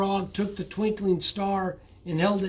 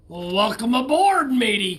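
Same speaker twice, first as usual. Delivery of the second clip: put-on loud, hearty old-sailor greeting; shouting welcome; 3 semitones higher, 6 dB louder than usual. A narrator's reading. Captain welcoming his friend aboard, hearty loud shout. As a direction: boom it out with a slap on the back. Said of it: "Captain Dalbert shouted".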